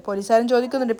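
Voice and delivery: usual speaking voice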